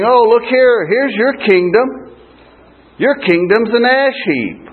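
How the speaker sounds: high, taunting jeer at a fallen man; mocking. Rich friends mocking Job on his ash heap, mocking. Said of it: "they were mocking him".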